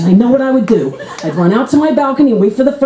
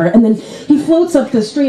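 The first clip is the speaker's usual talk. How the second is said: usual speaking voice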